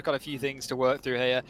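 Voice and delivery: normal talking voice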